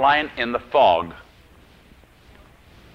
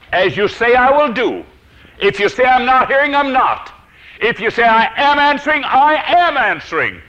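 high, loud preaching voice; ringing, emphatic declaration; forceful proclamation; 10 semitones higher, 6 dB louder than usual. Retold or imitated. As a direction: thunder it out as God's verdict. Preacher voices God's forceful answer.

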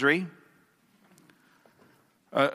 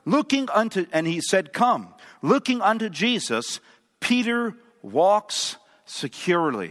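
higher-pitched, projected pulpit reading; dramatic devotional reading; 5 semitones higher than usual. A narrator's reading. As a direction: read the story out to a congregation. Reads a book passage aloud.

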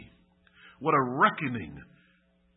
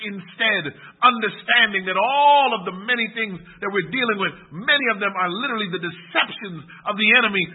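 loud, higher-pitched sermon appeal; impassioned preaching; 7 semitones higher, 9 dB louder than usual. Real, not acted. Preacher urging church to choose Christ over Barabbas, loud and impassioned. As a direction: preach it out forcefully, raised in pitch.

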